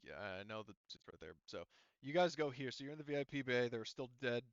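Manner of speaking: ordinary delivery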